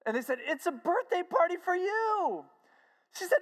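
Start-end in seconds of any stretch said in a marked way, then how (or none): 0.5-2.5 high, slow, excited crowd voice; retold joyful announcement. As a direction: break the happy news to her, high-pitched. Crowd announces the party to her.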